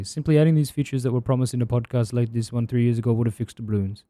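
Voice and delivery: low, soft-spoken impersonation; low, quiet put-on voice; Fozzie impression. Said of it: "I'm just doing his voice one to one".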